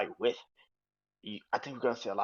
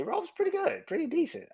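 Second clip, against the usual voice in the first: much higher sing-song; squeaky high-pitched mock praise; mock high verdict; 4 dB louder than usual. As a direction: sing it up high, tongue in cheek. Teasing rating of Raw.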